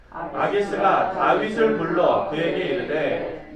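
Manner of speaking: measured solemn Bible reading; formal verse recitation; scripture reading. Acted. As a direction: read it out to the congregation. Reads scripture aloud.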